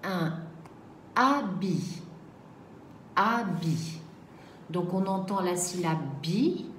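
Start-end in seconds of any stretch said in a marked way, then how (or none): 1.1-4.0 "habit" said clearly and repeated; dictation read. Shown as a demonstration. Teacher dictates word to pupils, careful repeat. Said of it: "Deuxième mot".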